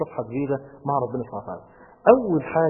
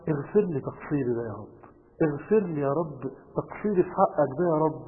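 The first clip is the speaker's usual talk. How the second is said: ordinary talk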